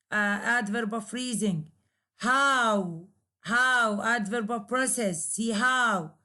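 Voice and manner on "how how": "How" firm with a falling tone; falling intonation. Shown as a demonstration. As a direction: say "How" so it glides downward. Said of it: "there is a falling intonation".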